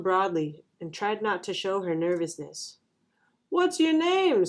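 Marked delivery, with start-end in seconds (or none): 3.5-4.5 high-pitched, moderately raised call; shouted question. A narrator's reading. Classmate calls to the new girl, mild shout. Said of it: "someone shouted".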